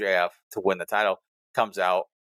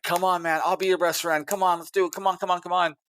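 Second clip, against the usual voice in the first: imitated urgent pleading; 9 semitones higher than usual. Partner begs wrestler to strike, imitated pleading. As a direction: egg him on in a high, pleading mock voice.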